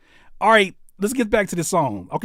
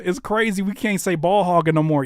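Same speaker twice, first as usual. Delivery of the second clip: ordinary talk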